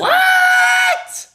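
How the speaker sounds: a sudden high yelp of disbelief; shocked shout. Real, not acted. Streamer hearing the foursome line, shouting in shock. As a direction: blurt it out, stunned and loud.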